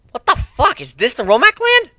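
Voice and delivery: high, loud startled swear; shocked outburst. A narrator's reading. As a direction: blurt it out in disbelief. Shocked at burned land.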